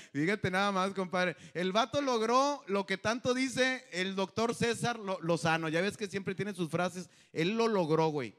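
normal talking voice

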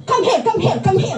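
a hint of the boss calling her over. Employer calls her over. (bahagyang gaya ng amo na tumatawag)

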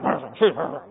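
low throaty brute grunt; mock ogre noises. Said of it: "these big ogres".

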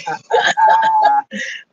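sudden high-pitched laugh; shrill squealing laugh; high squeal of laughter. Real, not acted. Laughs after greeting.

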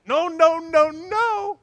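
high, loud, escalating no's; insistent loud no. Acted. Demanding customer rejecting slow shipping, loud and high.

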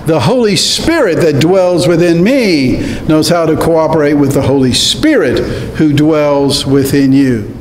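ordinary talk

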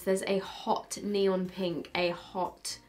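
ordinary talk, nothing marked

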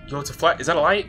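loud, startled questions. Real, not acted. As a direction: jump at the sudden light. Startled by sudden light.

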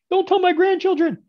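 high, loud caricature of a ruined trader; mock trader plea.

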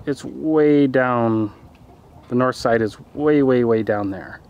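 ordinary delivery

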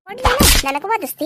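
loud, high furious threat; angry shout. Acted. Mother threatens child. (ಕೋಪದ ಜೋರು ಕೂಗು)

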